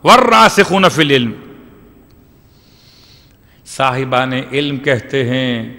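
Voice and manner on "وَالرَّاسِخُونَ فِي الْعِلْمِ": raised, fast chanted Arabic; fast raised recitation of the verse; Quran recitation. Shown as a demonstration. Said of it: "یہاں پر انہوں نے بیان کیا".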